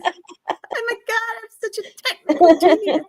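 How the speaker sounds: high mock Lily. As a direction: gush like a smug Lily. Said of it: "That's what she says".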